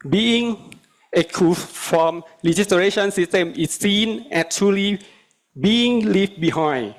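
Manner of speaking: usual speaking voice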